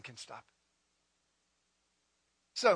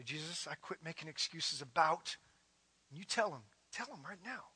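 quiet led prayer; 2 semitones lower, 6 dB quieter than usual. Acted. Leads prayer over music.

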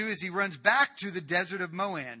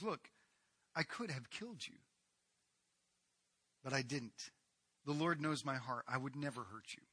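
soft plea; 3 semitones lower, 9 dB quieter than usual. Acted. David shows Saul the robe.

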